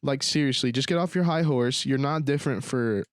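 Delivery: ordinary talk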